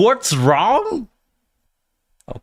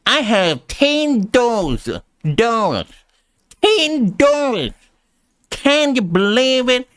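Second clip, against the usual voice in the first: slow, higher hammed-up Southern accent with ten said as tin; mock Southern drawl; 6 semitones higher, slower than usual. Streamer copying the accent teacher, mock Southern drawl. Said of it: "Seeing the Southern".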